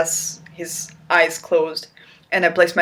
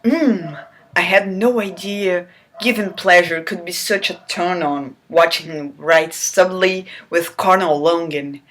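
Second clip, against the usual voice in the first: ordinary talk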